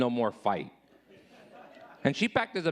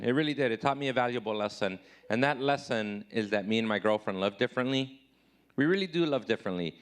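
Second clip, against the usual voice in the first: ordinary talk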